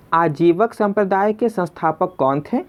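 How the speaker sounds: usual speaking voice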